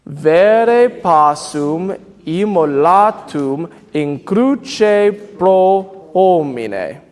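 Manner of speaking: slow, measured Latin for the choir to echo; slow choral recitation. Shown as a demonstration. Director speaks the line with the choir, slow recitation. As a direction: lead the group through each syllable, slowly. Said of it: "I'll say it with you".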